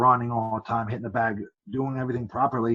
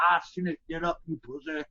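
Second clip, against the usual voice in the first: a light Southern referee impression, raised pitch; 5 semitones higher than usual. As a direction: mimic the Southern ref scolding the fallen fighter. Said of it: "'cause he talks all Southern".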